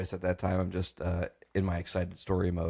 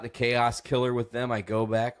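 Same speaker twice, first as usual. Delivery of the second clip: normal talking voice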